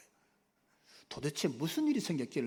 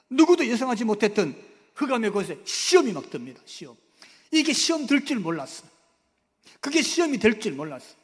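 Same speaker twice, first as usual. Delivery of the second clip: loud, driving preacher's delivery; forceful preaching; 5 semitones higher, 10 dB louder than usual. Acted. Preacher describing the seizure to the congregation, loud. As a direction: proclaim it with full force.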